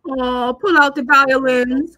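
sarcastic jab at the grieving mother; sarcastic mockery. Real, not acted. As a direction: drawl it out with scorn. Mocking mother's self-pity.

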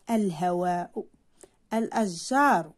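"الهواء" and "الأشجار" with lengthened aa; long vowel demo. Shown as a demonstration. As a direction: hold the aa in each listed word. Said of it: "تحتوي على مد".

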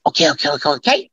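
rapid-fire repeated patter; imitated nervy, stuttering-fast hook; Joe Pesci. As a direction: rattle it off in quick bursts. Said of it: "he kept going".

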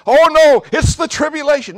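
high, loud mock alarm; hammed-up fearful cry about the end times; mock panicked believer. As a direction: squeal it like a panicky churchgoer. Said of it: "And somebody says".

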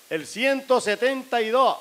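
high, loud, slow raffle call; drawn-out announcer shout. Acted. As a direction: call the winning number over the crowd. Raffle announcer calls number.

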